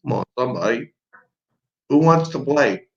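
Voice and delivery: ordinary delivery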